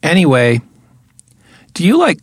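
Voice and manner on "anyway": usual speaking voice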